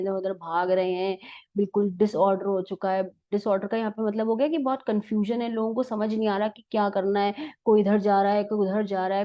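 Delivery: normal talking voice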